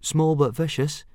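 put-on high, eager-to-help boy voice; helpful boy. A narrator's reading. Boy backing up his friend, helpfully. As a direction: pipe up helpfully on a friend's behalf. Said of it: "added Fishlegs helpfully".

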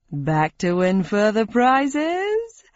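slow, smarmy quiz host; game show host voice. Acted. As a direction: draw it out like a TV host. Host teases returning contestant.

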